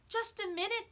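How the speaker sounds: put-on high, pleading child's voice; pleading boy. A narrator's reading. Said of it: "John pleaded".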